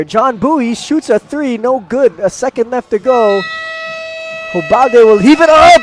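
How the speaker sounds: high, urgent, swelling to a loud cry; excited last-second call. Real, not acted. Commentator watches a last-second heave almost go in, thrilled. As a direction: explode at the near miss.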